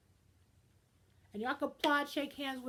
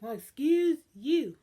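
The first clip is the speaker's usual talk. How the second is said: slow, louder, haughty put-down of the rider who got bumped; snooty retort; 2 semitones higher, 9 dB louder, slower than usual. Retold or imitated. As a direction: drag it out, offended and snobbish. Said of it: "And they're like".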